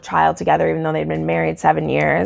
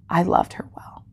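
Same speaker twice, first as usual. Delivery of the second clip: real choked-up whisper; 6 dB quieter than usual. Real, not acted. Reader moved by Jamie's farewell to Claire, choked up. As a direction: voice gives out into a tearful whisper. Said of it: "get so dramatic and cry".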